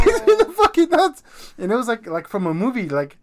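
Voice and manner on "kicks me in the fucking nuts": high and loud through laughter; laughing. Real, not acted. Dad laughing as he tells friend about kick. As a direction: crack up while telling it.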